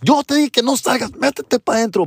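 high scolding mother voice; imitating a mom scolding her son. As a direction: scold the kid like an upset mom.

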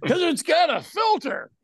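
high, jokey commercial exclamation; mock ad pitch. Host mocking old doctor cigarette adverts, mock ad pitch. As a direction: sell it like a ridiculous old ad.

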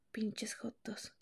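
a slow, breathy under-the-breath curse; whispered insult. Real, not acted. Muttered insult, then apologizes. (insulto susurrado y lento)

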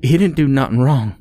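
high, loud, fast protest; pleading woman. Acted.

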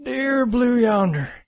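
put-on high, shaky cranky old geezer; old man voice. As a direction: read it like a grumpy old man yelling at kids. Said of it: "He wants it read in old man voice".

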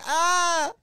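loud, high fake scream on the floor; playful scream. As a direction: let out a silly pretend scream. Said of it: "start screaming".

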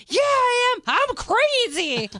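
high, loud, very slow unhinged drawl; hammed-up crazy mom, loud and high; imitating crazed mom. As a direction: play the mother gone mad, stretching every word. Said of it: "And you're like".

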